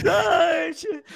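squealing high laughter; high giggling. Real, not acted. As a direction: shriek with laughter. Friend laughs at the scare.

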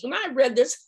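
ordinary talk

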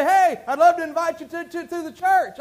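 acted-out eager greeting; eager invitation. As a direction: call out a keen invite, voice lifted. Acting out inviting someone to church.

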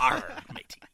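growled pirate catchphrase; playful storybook pirate growl. Acted. Co-host clowning for the other hosts, pirate voice. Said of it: "He makes a good pirate".

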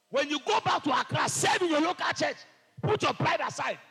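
loud, high fiery preaching; full-force preaching. Real, not acted. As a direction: thunder it at the congregation.

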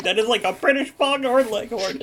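amused outburst through laughter; laughing quote. Retold or imitated. Hosts joking about a British Foghorn Leghorn, laughing. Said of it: "we were just like".

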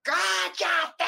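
high retold yell of shut up; mom yelling. Mom yells from her room at the crying sister, high mock yell. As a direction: snap it out like an angry mom from another room. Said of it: "Gritó desde el cuarto".

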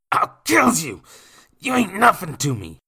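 loud, high enraged shout; high-pitched roaring fury; furious threat. Acted. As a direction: threaten at full voice in a drunken rage. Kicked drunkard threatens the boy.

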